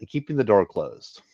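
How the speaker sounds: ordinary delivery, nothing marked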